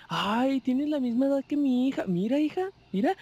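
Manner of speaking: a mother calling her daughter over, all excited; excited older lady. Retold or imitated. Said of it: "ella me dice". (imitando a una señora emocionada, mucho más aguda)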